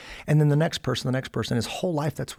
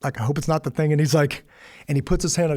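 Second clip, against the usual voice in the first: normal talking voice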